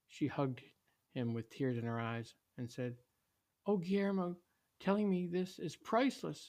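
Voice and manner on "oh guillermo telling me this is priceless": high, tender gratitude; high-voiced motherly relief; touched mother. A narrator's reading. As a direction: say it like a moved mom, pitched up. Grateful mom hugs her son.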